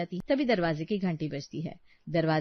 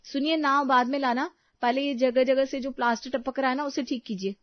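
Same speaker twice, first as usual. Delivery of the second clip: higher-pitched, nagging request; brisk, high-voiced instruction; insistent wife; 6 semitones higher than usual. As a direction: press husband to fix it first.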